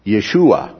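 Yeshua, said as yeh-SHOO-ah; original Hebrew name. Shown as a demonstration. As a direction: give Yeshua its Hebrew sound, stress on SHOO. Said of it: "in the original language would have been".

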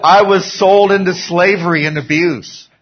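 loud, high victim act; hammed-up grievance; mock complaining. Acting Joseph's victim excuse.

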